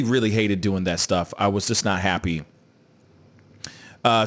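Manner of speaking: usual speaking voice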